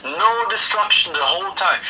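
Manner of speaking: usual speaking voice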